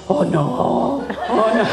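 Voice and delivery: laughing through a mock oh no; a touch of scribe's alarm, laughing; mock dismay. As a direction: tease the worried helper, chuckling. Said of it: "she's like".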